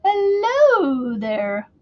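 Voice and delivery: very slow, high, sing-song hello there; Mrs. Doubtfire greeting. Retold or imitated. Showing viewers her Mrs. Doubtfire hello, slow and high. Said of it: "sounds like Missus Doubtfire".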